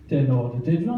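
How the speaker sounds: ordinary talk, nothing marked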